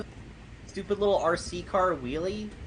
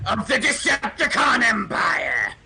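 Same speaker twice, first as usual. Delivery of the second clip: booming villain proclamation; 2 semitones lower, 5 dB louder than usual. Pretend. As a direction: proclaim it like an alien tyrant.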